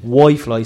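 "Wi-Fi" said as "Why-Fly"; "Wi-Fi" with a second syllable like "fly"; mispronounced Wi-Fi. Retold or imitated. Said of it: "she's been thinking Wi-Fi is Wi-Fi".

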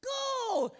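high, loud barked Go!; yelled command. Retold or imitated. Impatient passenger yelling at the driver, high loud shout.

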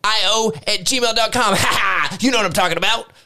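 hyped radio host. Acted. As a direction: hype up the listeners like a shock jock.

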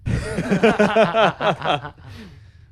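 high burst of laughter; loud laugh. Real, not acted. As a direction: crack up loudly. Laughing at the Connery bit.

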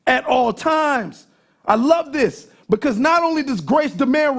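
high, loud sermon declaration; full-force preaching. Real, not acted. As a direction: ring it out to the whole congregation.

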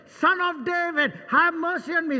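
high, loud cry for mercy; imitated shout. Blind beggar shouting to Jesus over the crowd, loud and high.

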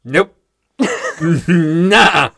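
loud, high, laughing no; loud laughing refusal. Real, not acted. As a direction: shout it down while laughing.

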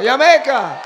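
loud, high Jamaican-style "Yah-MAY-kah"; Jamaican-style pronunciation. Shown as a demonstration. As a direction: shout Jamaica with a Y, playing Jamaican. Repeats it with Y sound.

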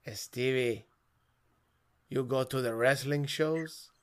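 put-on thick Spanish-accented dad, slow. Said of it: "an impression of my father".

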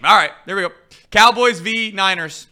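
loud, high announcer call. Acted. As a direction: call the matchup like a host. Announcing the next game.

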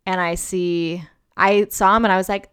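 ordinary talk, nothing marked

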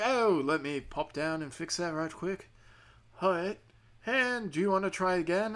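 breezy Australian phone agent, pitch lifting; put-on Australian accent. Fraud agent offering to fix the payment, Australian accent. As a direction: imitate an agent in loose Australian. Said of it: "He's Australian now".

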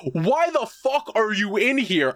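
stunned fan yelling at the screen; baffled outburst. Retold or imitated. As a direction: ask it in total disbelief. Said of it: "And I'm like".

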